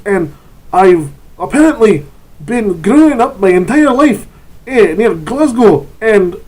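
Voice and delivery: loud, high mock-Scottish dwarf; hammed-up, singsong Scottish dwarf accent; dwarven Scottish accent. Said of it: "the dwarven Scottish accent thing".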